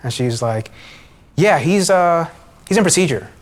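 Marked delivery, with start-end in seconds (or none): 1.4-3.3 higher, louder sing-song brush-off; mock a doctor stalling on the phone; evasive nurse voice. Female doctor dodges questions.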